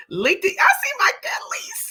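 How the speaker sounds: excited shout. Real, not acted. Spotting a friend in chat.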